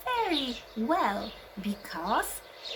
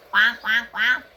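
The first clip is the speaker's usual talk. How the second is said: duck quack; 8 dB louder than usual. Shown as a demonstration. Imitating duck for children.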